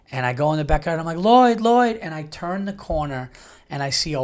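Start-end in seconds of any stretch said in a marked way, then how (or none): 1.2-2.0 high, louder re-enacted call; calling the dog. Owner calling his dog in the backyard, high raised retold call.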